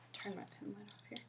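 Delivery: quick whispered self-talk; whispered aside. Real, not acted. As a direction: whisper it to yourself. Aside to self while examining.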